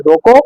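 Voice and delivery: "रोको" rising, then held off with a pause; stop after रोको. Shown as a demonstration. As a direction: say "रोको" alone and wait briefly. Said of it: "थोड़ी देर रुके जी".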